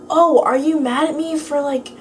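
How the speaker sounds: imitating a classmate getting touchy; defensive. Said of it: "she got all, like, defensive".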